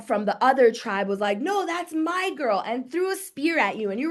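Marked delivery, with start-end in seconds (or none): 1.4-2.6 a raised, higher mock-rival voice; jealous rival. Rival tribesman threatens the picnicker, in a hammed-up higher voice. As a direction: snap it like a caveman staking a claim.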